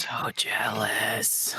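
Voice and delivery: whispered. Real, not acted. Envious aside.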